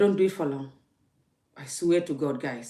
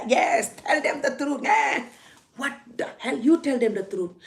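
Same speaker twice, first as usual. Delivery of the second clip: mock egging on; 7 semitones higher than usual. Imitating commenters egging her on.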